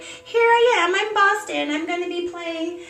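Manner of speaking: high, playful cat voice, lightly done; voicing the cat. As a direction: speak as the cat introducing herself.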